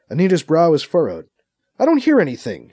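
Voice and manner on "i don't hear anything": higher-pitched frustration; frustrated, pitched-up complaint. A narrator's reading. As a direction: protest with mild exasperation. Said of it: "she says, frustrated".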